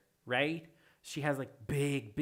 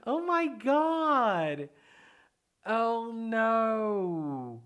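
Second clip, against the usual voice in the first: very high, loud squealing surprise; delighted squeal; 7 semitones higher, 5 dB louder, much slower than usual. Real, not acted. Streamer realizing a viewer was Galaxy Fish, squealing. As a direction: shriek with joy at who it was.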